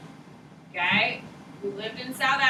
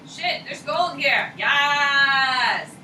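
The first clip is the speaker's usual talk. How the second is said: slowed, then a louder triumphant shout; excited discovery shout; 3 semitones higher, 6 dB louder, much slower than usual. Retold or imitated. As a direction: shout it like you struck gold. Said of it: "they're like".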